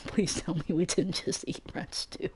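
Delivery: softly laughing through the words, breathy and quiet. Real, not acted. Narrator laughing in disbelief about eating rats. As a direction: half-whisper it while cracking up.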